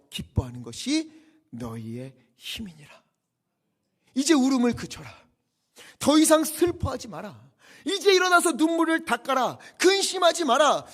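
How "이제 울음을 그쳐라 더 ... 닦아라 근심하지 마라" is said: high, loud exhortation; full-force preaching. Acted. Preacher urges the weeping people to stop grieving, full-force preaching. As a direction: cry it out to a weeping congregation.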